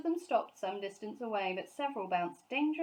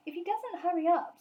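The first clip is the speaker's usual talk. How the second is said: much higher, louder worried mother; fretting mother, pitched up; Mrs Brown's voice; 10 semitones higher, 7 dB louder than usual. A narrator's reading.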